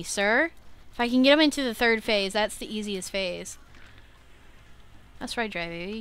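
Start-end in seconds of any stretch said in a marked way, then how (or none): none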